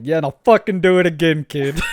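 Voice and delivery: loud, mock-tough retort; defiant teacher. Teacher caught swearing on stream, loud mock defiance. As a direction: fire it back at the kid.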